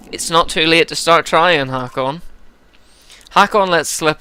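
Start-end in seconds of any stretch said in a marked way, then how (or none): none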